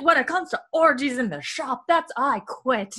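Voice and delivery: lightly hammed scandalized shopkeeper; indignant Jordy. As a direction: play it prim and fed up. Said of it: "He's like".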